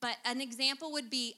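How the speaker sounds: usual speaking voice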